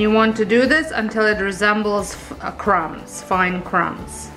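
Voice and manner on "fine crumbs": ordinary delivery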